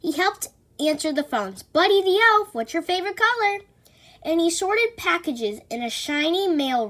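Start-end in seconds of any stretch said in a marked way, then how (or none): 1.6-3.6 high, loud and fast chirpy greeting; chirpy phone greeting. Acted. Buddy the elf answering office phones, overly chirpy. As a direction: bubble over into the phone.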